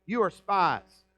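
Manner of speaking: mildly harsh accusation, loud and drawn out. Retold or imitated. Joseph to his brothers he pretends not to know, mock harsh. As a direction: accuse them slowly and firmly, in retelling. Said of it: "spoke to them harshly".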